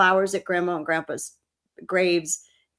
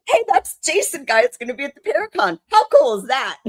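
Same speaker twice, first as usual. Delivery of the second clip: higher, louder giddy retelling to Chris; excited gushing; 7 semitones higher, 5 dB louder than usual. Retold or imitated. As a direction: gush like a starstruck fan. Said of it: "I'm like".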